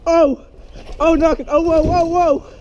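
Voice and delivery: high repeated whoas; high alarmed whoa whoa; startled cries. Real, not acted. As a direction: yelp while fighting for balance. Near fall again.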